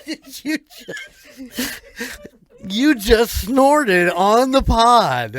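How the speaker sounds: drawn out slow through laughter; can barely get it out for laughing. Real, not acted. Host teasing cohost who snorted on air, laughing hard.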